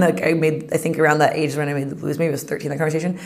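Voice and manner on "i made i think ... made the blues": ordinary delivery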